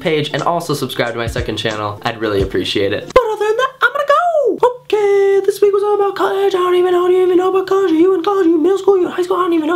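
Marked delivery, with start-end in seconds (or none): 3.1-9.8 very high, silly rambling squeak; squeaky sign-off voice. Pretend. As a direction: babble the goodbye in a tiny squeaky voice.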